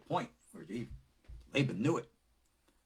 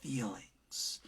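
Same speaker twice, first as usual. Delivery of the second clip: hushed emphasis; 2 semitones lower, 8 dB quieter than usual. Shown as a demonstration. Preacher stressing "feelings" to listeners, whispered. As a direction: breathe the word "feelings" in a soft whisper. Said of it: "Notice I emphasized the word".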